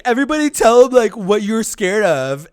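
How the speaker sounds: high, loud imitation of a taunting kid; mocking group voice. Laughing classmates put someone on the spot, mocking voice.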